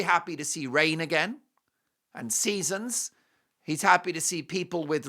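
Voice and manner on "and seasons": usual speaking voice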